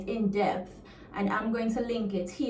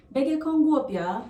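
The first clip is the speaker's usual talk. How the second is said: higher, fast Jamaican patois; 5 semitones higher, 4 dB louder, faster than usual. Shown as a demonstration. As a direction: say it Jamaican, quick and lifted. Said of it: "it can mean".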